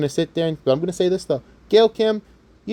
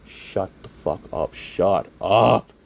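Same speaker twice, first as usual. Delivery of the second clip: low, slow, hushed mock exasperation; fed-up partner; 8 semitones lower, much slower than usual. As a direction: drag it out under your breath, stronger at the end. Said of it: "up the ceiling going".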